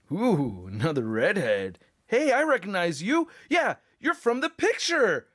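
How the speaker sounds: cartoonish figure voice with wildly swooping pitch; hammed-up Spider-Man toy, smitten fan.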